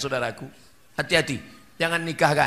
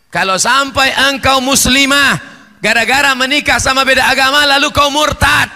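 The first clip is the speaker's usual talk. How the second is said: loud and higher, fast hellfire warning; fiery warning; 7 semitones higher, 7 dB louder than usual. Real, not acted. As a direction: thunder the threat of hell at them. Preacher warning of hell.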